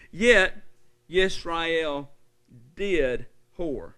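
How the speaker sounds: loud, weighty pulpit voice with pauses; preaching rebuke. Acted. Preacher condemning Israel to the congregation, loud deliberate preaching. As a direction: land each word like a verdict.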